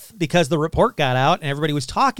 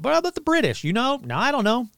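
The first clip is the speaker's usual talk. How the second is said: much higher, half-comic pleading question; mock bewildered asking; 7 semitones higher, faster than usual. Allied officers asking each other in much higher, mock-baffled voices.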